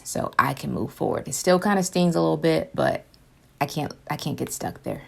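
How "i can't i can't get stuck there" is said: ordinary talk, nothing marked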